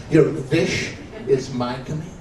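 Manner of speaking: slow line, WISH said as "vish"; Yiddish-accented wish. Acted. Old husband to wife on their anniversary, Yiddish-accented "vish". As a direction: draw it out, wish with a V sound. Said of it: "V I S".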